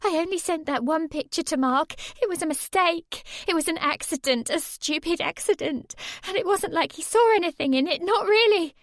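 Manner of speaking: high rushed pleading; desperate pleading. A narrator's reading. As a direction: talk fast to save yourself. Sammy pleading with her attacker.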